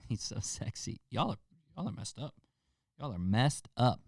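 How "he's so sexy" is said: whispery, unvoiced fangirl gush; hushed, laughing mock swoon; mock swooning fan. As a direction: whisper it like a smitten admirer, mockingly. Mocking a swooning commenter.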